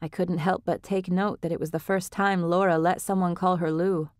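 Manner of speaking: plain reading voice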